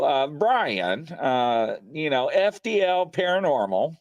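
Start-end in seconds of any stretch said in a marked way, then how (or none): none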